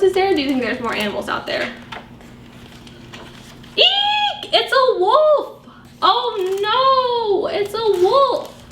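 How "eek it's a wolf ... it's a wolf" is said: high squeal of storybook fright, slowed on wolf; mock fright. Reading to children, a wolf at the door: hammed-up fright. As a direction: play it scared for the kids.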